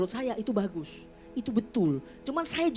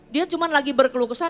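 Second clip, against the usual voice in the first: usual speaking voice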